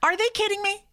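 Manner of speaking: high, disbelieving outcry; can't believe it, voice shooting up; incredulous. Real, not acted. Outraged at the drug name.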